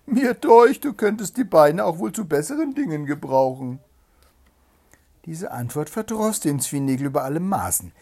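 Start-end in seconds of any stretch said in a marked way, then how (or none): 0.1-3.8 high, loud, haughty hare; scornful. A narrator's reading. Hare mocking the hedgehog's legs, haughty scorn. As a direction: talk down to him, loud and high.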